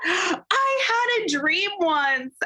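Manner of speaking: high, slow, tearful wail; hammed-up weepy confession; imitating sobbing interviewer. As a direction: play her breaking down in tears. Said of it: "she starts bawling".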